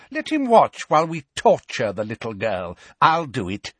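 a hint of a gleeful cruel witch; lightly voiced eager cruelty. A narrator's reading. Bellatrix threatening the little girl, lightly voiced cruel glee.

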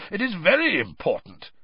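growled challenge, emphatic; Moody. A narrator's reading. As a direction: growl the question suspiciously. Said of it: "growled Moody".

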